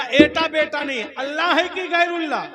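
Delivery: high, shouted interrogation; badgering challenge. Sunni cuts off cleric's excuse, shouting insistently.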